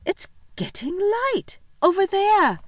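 high, loud, stretched-out wonder; amazed excitement. Acted. Susan spots light among the trees.

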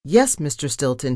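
plain reading voice

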